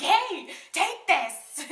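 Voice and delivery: high, loud, drawn-out shout; stretched-out, forceful yell; aggressive mock yell. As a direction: holler it as if hurling something. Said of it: "threw it at him".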